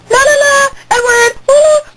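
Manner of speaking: high, excited fangirl squeal; hyper. Real, not acted. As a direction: bounce around giddily showing off the shirt. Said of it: "I'm a little hyper".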